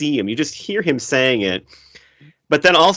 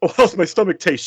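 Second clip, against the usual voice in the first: mocking imitation; 7 semitones higher than usual. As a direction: blow the line up like a moron. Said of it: "just the way he goes".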